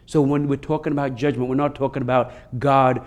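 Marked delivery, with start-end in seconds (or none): none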